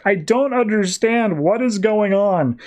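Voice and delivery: high and slow, baffled; bewildered Superman. Retold or imitated. Battered Superman asking Ulysses what is happening, slow and baffled.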